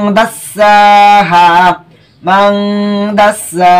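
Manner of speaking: মাংদাসাহা with a long hummed "ng", then long সা and হা; ghunnah and long vowels. Shown as a demonstration. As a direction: recite মাংদাসাহা slowly, stretching the nasal and both final vowels. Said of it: "লম্বা করতে হবে".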